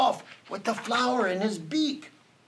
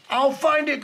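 high, determined cry; 7 semitones higher, 4 dB louder than usual. A narrator's reading. Elephant vows to find his friends, crying out. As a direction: cry out the vow with conviction. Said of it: "cried Horton".

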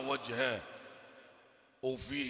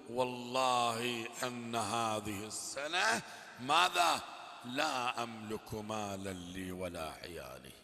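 lower, slow tearful lament; weeping; 5 semitones lower, 3 dB quieter, slower than usual. Retold or imitated. The beggar weeping at the door, slow and low. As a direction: grieve it out slowly, close to tears. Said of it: "جلس عند الباب باكيا".

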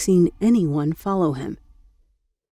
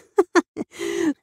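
burst of laughter; 12 semitones higher than usual. A narrator's reading. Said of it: "burst out laughing".

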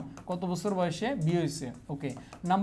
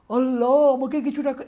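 exclamation; 5 semitones higher, 6 dB louder than usual. Shown as a demonstration. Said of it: "এখানে তো অবাক".

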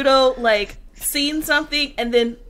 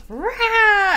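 mock battle roar; 6 semitones higher than usual. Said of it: "she goes".

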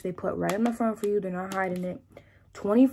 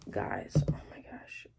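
breathless whisper; 3 semitones lower, 4 dB quieter, slower than usual. Real, not acted. Overwhelmed aside.

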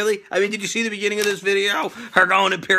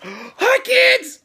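high character greeting; 14 semitones higher than usual. Acted. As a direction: belt out a cheery catchphrase way up high.

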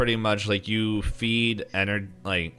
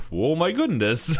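mock surprise; 8 semitones higher, 4 dB louder than usual. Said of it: "you guys don't have to play, like".

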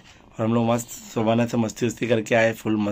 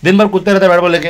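excited teasing; 7 semitones higher, 7 dB louder, faster than usual. Real, not acted. Teasing about son jumping.

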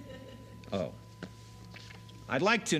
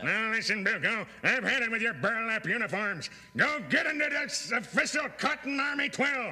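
stern colonel; 8 semitones higher than usual. Acted. Colonel scolding Bilko.

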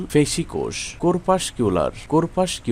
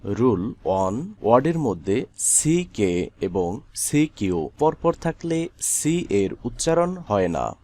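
ordinary talk, nothing marked

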